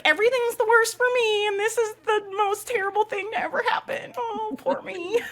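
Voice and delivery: very high mock-wailing self-pity; hammed-up woe-is-me squeal through laughter; dramatic brain. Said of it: "dramatic ass brain".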